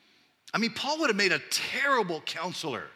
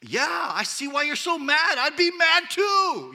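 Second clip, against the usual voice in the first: much higher, louder, slower; fired-up sympathy; 12 semitones higher, 8 dB louder, slower than usual. Retold or imitated. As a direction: side with them completely, fired up. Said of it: "before I'm like".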